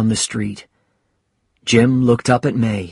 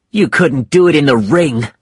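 raised, louder angry taunt; heated challenge to a fighter; 5 semitones higher, 5 dB louder than usual. Acted. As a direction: throw it at him angrily, higher and louder. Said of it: "said Mike angrily".

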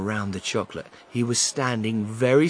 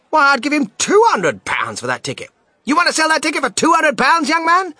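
loud bidding; 10 dB louder than usual. Acted. Woman bids loudly.

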